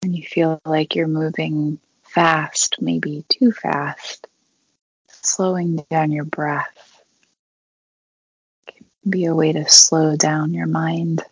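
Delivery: unhurried meditation guide with long pauses; guided meditation. Acted. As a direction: guide them gently through breathing, leaving long silences.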